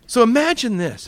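usual speaking voice

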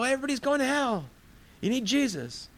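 high-pitched caricatured street preacher; mock zealot preaching. Zealot warns everybody without knowing the message, mock preaching. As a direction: push the voice way up, alarmist.

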